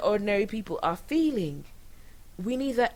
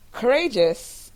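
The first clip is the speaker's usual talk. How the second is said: sarcastic; 3 semitones higher, 7 dB louder, slower than usual. Real, not acted. Mocking the first lady's bold claim.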